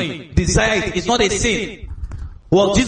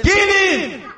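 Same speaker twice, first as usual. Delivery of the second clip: retold crowd yell, much higher; crowd shout; 13 semitones higher than usual. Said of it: "Everybody shouted".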